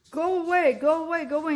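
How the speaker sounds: high, loud, desperate shooing; mock scream. Crying wife shooing her husband away, loud and high. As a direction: push him out with a frantic cry. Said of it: "trying to scream and tell him".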